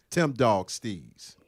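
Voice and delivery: ordinary delivery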